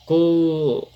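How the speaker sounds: "co-" isolated and held; stressed syllable CO. Shown as a demonstration. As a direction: sound out "co-" alone as the strong syllable. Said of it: "qual que é a sílaba mais forte". ("co-" isolado, como sílaba forte)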